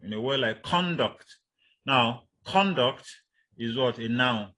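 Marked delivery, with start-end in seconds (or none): 0.6-1.2 "conduct" with CON stressed, falling on -duct; stress on first syllable. Shown as a demonstration. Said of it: "On the first syllable". 2.4-3.1 "conduct" drawn out slowly, CON stressed; slow demo of "conduct" with weight on CON; stress on first syllable. Shown as a demonstration. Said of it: "On the first syllable".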